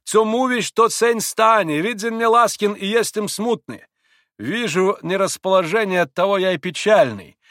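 high, loud, fervent protest; crying out. A narrator's reading. Pole assures Grushenka and agrees to play, declaiming loudly. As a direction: declaim it to win her over. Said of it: "крикнул пан на диване".

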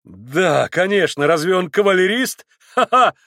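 loud mocking shout with a laugh. A narrator's reading. Mitya mocks Maximov, shouting with a laugh. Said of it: "крикнул Митя".

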